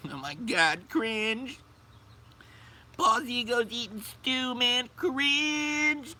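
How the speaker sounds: high, nasal-free squeaky mock whine; mock a whiny kid, way up high. Mocking whiny cringe viewer.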